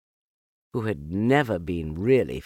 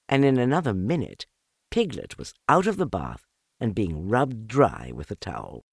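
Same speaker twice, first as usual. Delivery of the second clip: ordinary delivery, nothing marked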